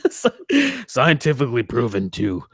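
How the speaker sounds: laughing through a fake product claim; mock ad pitch. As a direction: sell it like a cheesy supplement ad. Parody of a supplement ad.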